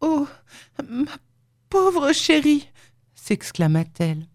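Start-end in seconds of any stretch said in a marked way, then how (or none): 0.0-2.7 high, pitying cry with little pauses; lightly acted distress, pitched well up; tearful exclamation. A narrator's reading. Leonora at the narrator's bedside, tearful pity. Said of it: "elle éclata en sanglots".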